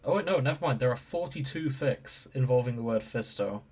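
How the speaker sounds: ordinary talk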